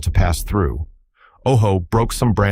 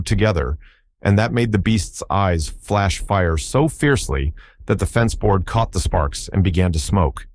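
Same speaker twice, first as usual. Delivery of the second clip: usual speaking voice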